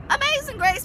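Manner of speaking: squealed far up high in mockery; mocking hymn title. Kid mocking the hymn she was asked to sing, high squeal.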